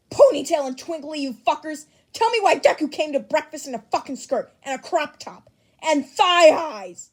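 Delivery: loud angry yelling at the class; furious shout. Acted. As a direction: bellow it, outraged. Bakugo yelling at classmates in chat.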